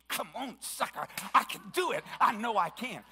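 loud, fired-up self-talk; pumped up. Shown as a demonstration. Preacher pumping himself up while exercising. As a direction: psych yourself up like mid-workout. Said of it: "I get myself pumped up".

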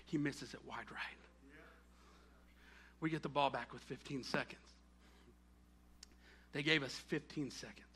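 low, soft storytelling; hushed suspense. Real, not acted. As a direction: hold the tension with quiet, low delivery. Suspense before the final fifteen seconds.

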